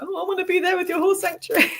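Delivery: high, pining wistful whine; a playful jealous moan; whiny envious voice. Retold or imitated. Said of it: "I'm a bit like".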